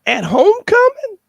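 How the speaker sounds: high, loud, laughing disbelief; buddy can't believe the plan, cracking up; shocked laughing disbelief. Retold or imitated. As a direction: squeal it through a laugh. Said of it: "one of my buddies said".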